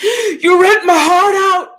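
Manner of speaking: high, loud, slow tearful cry of a wounded lover; mock heartbroken wail. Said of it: "Johnny Depp being like".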